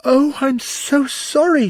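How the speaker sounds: high, loud, drawn-out apology; flustered, wailing apology; exclaiming. A narrator's reading. Alice after upsetting the jury box, exclaiming high. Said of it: "she exclaimed".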